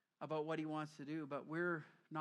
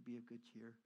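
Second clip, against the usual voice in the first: softer, tender reassurance; soft and gentle; 6 semitones lower, 10 dB quieter than usual. Retold or imitated. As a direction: say it kindly like a comfort. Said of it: "nice when they say".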